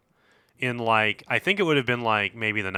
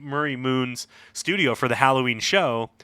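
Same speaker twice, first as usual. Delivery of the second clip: usual speaking voice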